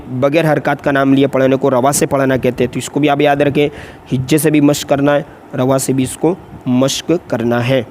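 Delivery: ordinary talk, nothing marked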